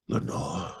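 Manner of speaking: soft secret call into the dark; whispered name. Acted. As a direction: let the name barely escape. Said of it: "a whispered word".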